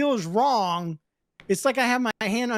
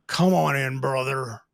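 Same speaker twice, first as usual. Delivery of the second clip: deep bro greeting; 7 semitones lower than usual. Retold or imitated. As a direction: greet him like a big bro. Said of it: "You're getting hugged, buddy".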